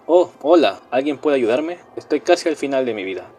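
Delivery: plain reading voice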